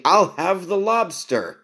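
high, lofty upper-class voice; aristocrat. Retold or imitated. Ordering lobster at the restaurant, lofty aristocrat voice. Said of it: "Like some aristocrat".

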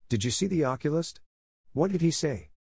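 high-pitched, mildly anxious questions; anxiously pressing for news; anxious questioning. A narrator's reading. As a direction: ask with worried urgency. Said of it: "asked Anne anxiously".

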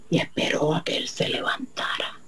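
hushed narration; whisper. A narrator's reading. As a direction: breathe it like a secret. Narración en secreto.

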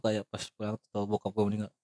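ordinary talk

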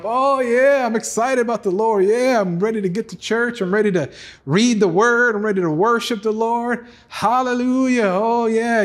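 high, giddy churchgoer gushing; sing-song mock enthusiasm of a fair-weather believer; mock excited believer. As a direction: ham up a fickle fan's excitement. Mocking fickle excited believer.

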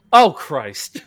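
loud groan of regret, hammed-up; mock horror. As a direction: blurt it in theatrical dismay. Acted horror after sending a message.

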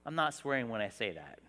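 loud, rising preacher's call; preaching at full force. Acted. Pastor preaching to his church about unity, at full force.

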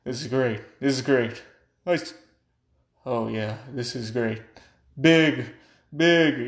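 mock Trump voice, building to loud; hammy Trump impression ending loud; imitating Trump. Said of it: "I just watched the president say".

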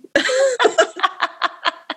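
shrieking giggle; high squeal of a laugh. Real, not acted. Cohost joking about Jamaican accents, high laugh. Laughing at her own joke.

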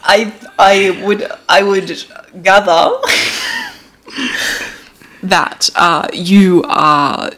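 put-on slow, halting try at a German accent; attempted German accent. Friend tries a German accent for the game. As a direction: drag out a bad German accent. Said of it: "I'm gonna go German".

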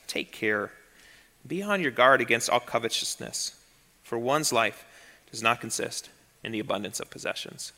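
soft, low gentle warning; Jesus softly warning the young man; gentler tone. Retold or imitated. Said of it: "how the tone switches".

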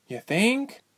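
high-pitched dry retort; dryly. A narrator's reading. Ilse answers Tyke's teasing, high dry voice. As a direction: toss it back high and dry. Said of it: "Ilse said dryly".

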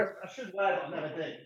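distant, off-mic voice; from another room. Real, not acted. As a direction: speak from the next room. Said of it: "Yeah, I'm in the bedroom".

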